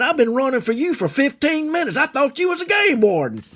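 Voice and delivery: high, worked-up complaint; fussing old man. Retold or imitated. Old man fussing that he mistook the boy for a warden, high voice. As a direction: scold in a strained high pitch. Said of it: "he fussed at me".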